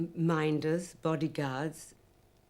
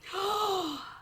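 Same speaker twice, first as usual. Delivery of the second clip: mock gasp; 13 semitones higher than usual. Imitating the heckling crowd.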